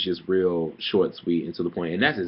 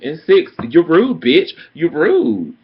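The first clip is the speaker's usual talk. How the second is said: higher and louder, punching rude; loud climax; 7 semitones higher, 8 dB louder, slower than usual. Real, not acted. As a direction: build to a loud finish on the last insult. Counting insults, climax.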